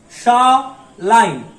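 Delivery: शा line said slowly, each syllable drawn out; slow naming demo. Shown as a demonstration. Teacher naming the kana row to students, slowly. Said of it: "एक साथ में कहते हैं".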